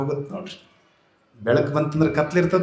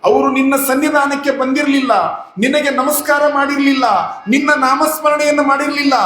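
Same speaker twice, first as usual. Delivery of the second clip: high, pleading lament to god in a wife's voice; a wife begging god, high and pleading; grieving wife's lament; 9 semitones higher than usual. Acted. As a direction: cry out to god for your husband.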